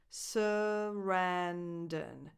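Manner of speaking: very slow "Sarandon", first and third vowels reduced; "Sarandon" in slow motion with schwas around RAN. Shown as a demonstration. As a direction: draw out "Sarandon" as suh-RAN-dun. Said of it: "first and the third vowels are just schwa sounds".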